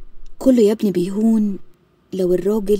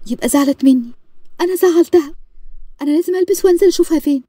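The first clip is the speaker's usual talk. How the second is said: higher, louder, rushing with worry; panicked daughter; 8 semitones higher, 3 dB louder than usual. Acted. Daughter panicking to her father that she upset her mother, higher and rushed.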